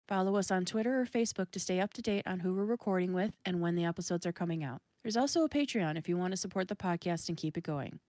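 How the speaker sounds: soft, evenly read sign-off; podcast outro read. Real, not acted. Host thanking guest and fans at episode's end, outro read. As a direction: read the credits quietly to the listeners.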